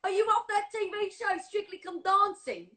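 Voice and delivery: put-on English cabbie accent. Imitating a cabbie asking about Strictly, English accent. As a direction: put on an eager English cab driver's accent.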